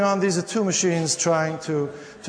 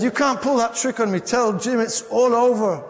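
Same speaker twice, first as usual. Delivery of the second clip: pitched-up mock outrage, slowing at the end; indignant fiancée; 5 semitones higher than usual. Fiancée ending it through the answering machine, indignant. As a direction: act a jilted caller laying down the law.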